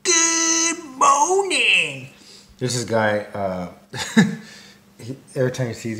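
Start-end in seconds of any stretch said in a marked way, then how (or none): 0.0-2.1 very high, long drawn-out impression; guy's morning greeting. Imitating a guy who greets people every time, high drawn-out greeting. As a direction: hold each vowel high, mimicking him. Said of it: "he's like".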